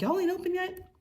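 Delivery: mock James yelling in a high silly voice; hammed-up drunk guy asking if the bar is open; imitating James. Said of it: "And he's like".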